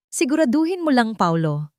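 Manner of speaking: high, playful teasing warning; fiancée teasingly warning Paolo while eyeing Teresa; teasing smile. Acted. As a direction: tease him with a smile in the voice. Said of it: "nakangiti ng mapanukso".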